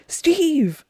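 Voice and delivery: high, falling cry of a name; mock yell. Host quoting Shepard as the shuttle crashes, mock yell. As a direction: call it out high, without real volume. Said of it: "any Shepard yelling".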